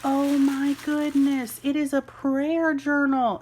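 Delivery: real delight; delighted gush. Real, not acted. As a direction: gush over the prayer journal. Opening gift package.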